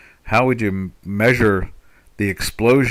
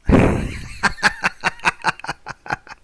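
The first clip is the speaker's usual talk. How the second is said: long, high laugh; 10 semitones higher than usual. Real, not acted. Host amused by own Chicago pronunciation joke, laughing. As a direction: burst into a high, breathy laugh.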